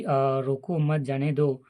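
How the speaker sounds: ordinary talk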